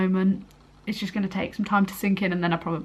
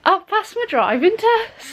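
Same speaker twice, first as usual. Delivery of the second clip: much higher, slow, stressed repeat; excited insisting; 12 semitones higher, slower than usual. Real, not acted. Repeating to her disbelieving mum, slow high insistence. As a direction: say it again, slower and thrilled. Repeating after mum's "What?.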